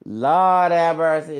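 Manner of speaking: loud, drawn-out Lord have mercy; exclaiming. Real, not acted. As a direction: cry out to heaven, slowly. Mock outrage over the video.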